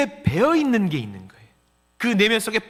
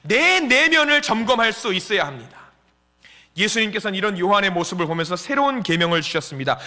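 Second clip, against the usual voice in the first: ordinary talk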